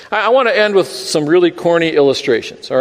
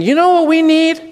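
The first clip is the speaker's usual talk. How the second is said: much higher, louder mock question; mocking churchgoer; 14 semitones higher, 3 dB louder than usual. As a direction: ham up the church crowd wanting things. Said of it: "a group of believers ... thinking".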